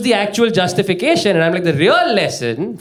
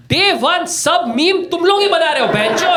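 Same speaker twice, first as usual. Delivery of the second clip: angry rant; 11 semitones higher than usual. Real, not acted. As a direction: vent it at full pitch. Fed-up rant about memers.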